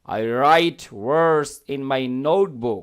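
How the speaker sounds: very slow, word by word; slow model sentence. Shown as a demonstration. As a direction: dictate it slowly to the class. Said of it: "teacher models the sentence".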